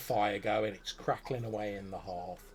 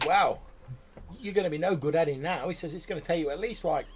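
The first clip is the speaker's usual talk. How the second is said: high, louder goblin warning; 6 semitones higher, 5 dB louder than usual. Acted. Goblin warning the groom about his wedding, high. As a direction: goblin points out a snag.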